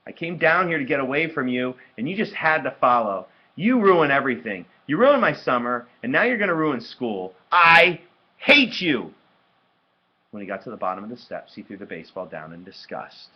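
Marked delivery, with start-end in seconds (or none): 0.1-9.1 furious outburst. A narrator's reading. Brother blaming sister for ruining everything, furious.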